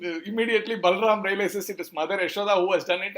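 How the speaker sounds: normal talking voice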